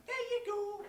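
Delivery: put-on high-pitched wee boy voice; little boy. Wee boy tossing coins to a stranger, childlike high voice. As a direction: chirp it high like a small child. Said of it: "the wee boy went".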